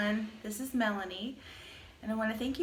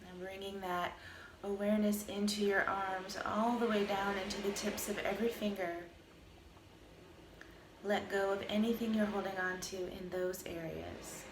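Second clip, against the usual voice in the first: calm, even meditation voice with long pauses; guided meditation; 5 dB quieter than usual. Acted. Teacher guiding listeners through a body scan, calm meditation voice. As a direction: lead a body scan, unhurried, leaving space between phrases.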